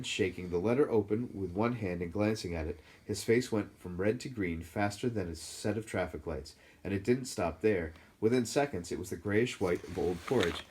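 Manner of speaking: plain reading voice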